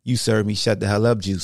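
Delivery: ordinary talk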